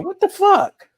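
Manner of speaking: high, loud mock outrage; outraged exclamation. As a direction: blurt it out in disbelief. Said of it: "without people saying".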